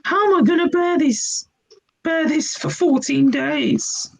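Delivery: high whiny moan; whining Henry.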